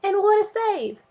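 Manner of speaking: high, thrilled narration; excited. A narrator's reading. Narrator cheering Froggy's save, excited. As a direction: cheer it like a sportscaster.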